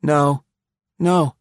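high, earnest insistence; a touch of earnest pleading; earnestly. A narrator's reading. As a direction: insist with sincere conviction. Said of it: "he repeated earnestly".

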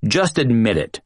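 plain reading voice

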